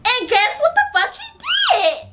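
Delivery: high, laughing near-shout of disbelief; cracking up while calling out the outrage. Real, not acted. Telling friends about the boy, high laughing shout.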